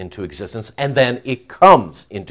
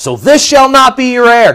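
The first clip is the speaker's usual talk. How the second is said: stern; 12 semitones higher, 7 dB louder than usual. Acted. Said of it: "in a stern, direct manner".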